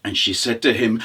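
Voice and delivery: plain reading voice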